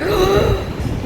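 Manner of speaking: high, audible gasp of wonder; retold gasp. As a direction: act out a sharp intake of awe. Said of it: "I was like".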